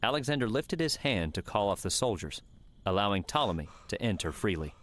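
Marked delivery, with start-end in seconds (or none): none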